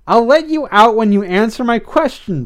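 high, firm demand. A narrator's reading. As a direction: lay down the condition forcefully. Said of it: "Blythe demanded".

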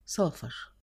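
a soft, low, resigned سافر; quiet giving up. A narrator's reading. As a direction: let سافر fall away in surrender. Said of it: "فقالت باستسلام".

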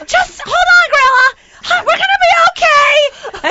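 high, loud, frantic reassurance; panicked shouting. Retold or imitated. Woman shouting back to Grandma in danger, panicked. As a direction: yell comfort while panicking yourself.

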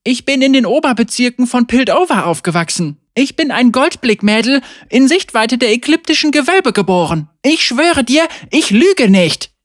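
loud, high, insisting; defiant. Acted. Tamara insists to Caitlin she is from Piltover, defiant. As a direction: stand your ground, defiant. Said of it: "Tamara stierte sie trotzig an".

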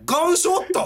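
high, sharp disbelieving echo; shocked echo. Retold or imitated. Told a gun went off at the party, shocked high echo. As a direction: blurt it out in disbelief. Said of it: "っつって".

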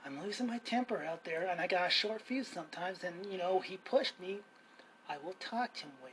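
soft, subdued retelling; low-key, softened self-quote; calmed down. Retold or imitated. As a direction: explain it quietly after cooling off. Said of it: "And I kinda calmed down".

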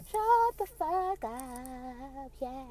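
slow stretched repeat; drawn-out echo. Pretend. Podcaster mock-slow echo of her rant. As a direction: drag out the retort.

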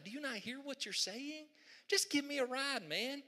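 high, slow, wheedling scorpion voice; sly scorpion. Acted. Scorpion talking the frog into a ride, slow and high. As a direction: coax the frog like a smooth talker.

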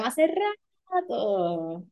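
hace rato drawn out very slowly; slow exaggerated hace rato; exaggerated drawl. Shown as a demonstration. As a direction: stretch hace rato to mean ages. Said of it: "by exaggerating how you pronounce".

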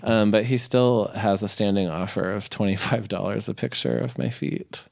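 usual speaking voice

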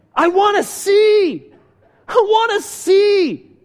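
loud, high, drawn-out plea; desperate cry. Retold or imitated. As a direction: cry out as a blind beggar pleading. Acts blind man's desperate cry.

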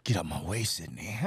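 low, restrained warning; imitating angry Lee. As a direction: warn a friend off in a dropped voice. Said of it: "He's like".

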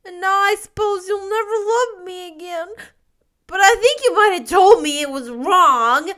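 put-on high, loud, wailing child voice; stormily crying boy. A narrator's reading. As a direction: protest and apologize through childish sobs. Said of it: "cried stormily".

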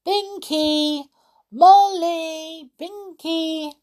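high, loud calling out across the street; shouting for Molly and Binky through the village; shouting names. A narrator's reading. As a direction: call out for lost friends, loud and high. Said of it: "he shouted loudly".

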